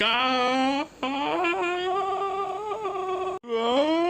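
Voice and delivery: very high falsetto crying wail; mock scared falsetto sobs; hammed-up high-pitched wailing. Girl reacts to him approaching.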